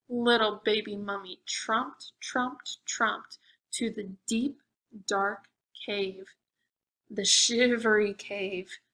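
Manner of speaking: ordinary delivery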